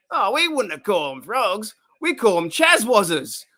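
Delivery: put-on high, squeaky Australian shop kid; squeaky Australian guy. Squeaky Aussie shop kid tells Marge the frog name, high and loud. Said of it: "And the team goes".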